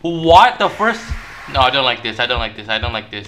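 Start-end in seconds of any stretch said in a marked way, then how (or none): 0.0-1.0 high, loud incredulous question; shocked. Real, not acted. Player reacts to the first person, shocked. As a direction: blurt it out in disbelief.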